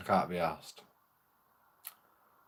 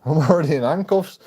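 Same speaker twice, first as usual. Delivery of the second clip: louder, higher, amused disbelief; laughing disbelief; 5 semitones higher, 7 dB louder than usual. Retold or imitated. Telling the officer he's cuffed already, louder amused disbelief.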